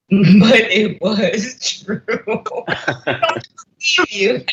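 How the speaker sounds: slow amused repeat through laughter; laughing echo. Real, not acted. Friend laughing back at the blunt truth, laughing. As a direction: laugh as you agree it was true.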